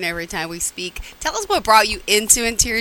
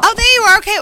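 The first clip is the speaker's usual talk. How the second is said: bright, much higher and louder greeting of relief; relieved surprise; 12 semitones higher, 8 dB louder, faster than usual. Real, not acted. Host relieved as the guest reappears on the call, surprised and loud. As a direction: greet her like she's just popped back into view.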